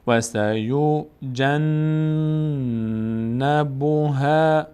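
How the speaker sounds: "سیجنبها" very slowly, humming on the doubled N; held nasal noon. Shown as a demonstration. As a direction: let the N in "سیجنبها" ring through the nose.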